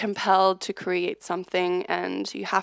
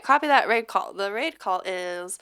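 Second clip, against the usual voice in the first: normal talking voice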